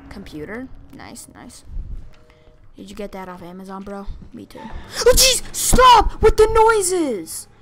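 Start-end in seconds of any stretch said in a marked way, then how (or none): 4.6-7.5 high, loud shriek, drawn out at the end; startled shouting. Real, not acted. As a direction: yell in fright at the noises. Startled by game noises.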